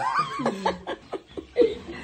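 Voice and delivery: high squealing laugh; real laugh. Real, not acted. Laughing because he missed filming the hit.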